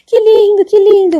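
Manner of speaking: high thrilled cries of joy; an old lady overjoyed at the sight; delighted old woman. Retold or imitated. Old lady in heaven, gushing with joy. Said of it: "e ela toda feliz".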